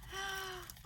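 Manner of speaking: soft, sharp intake of breath; delighted gasp. Real, not acted. Seeing the gifted squares, delighted gasp. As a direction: gasp at a lovely surprise.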